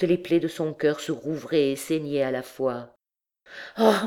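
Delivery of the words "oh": startled cry, lightly acted; cried out. A narrator's reading. Woman recoiling from the priest, crying out. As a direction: let out a short cry of dismay. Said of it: "cria-t-elle".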